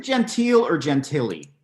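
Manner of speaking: Gentile as jen-TEEL, Gentili as jen-TILL-ee; checking pronunciation. Real, not acted. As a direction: contrast two-syllable Gentile with three-syllable Gentili. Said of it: "I've been pronouncing it wrong".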